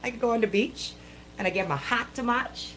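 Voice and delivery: ordinary talk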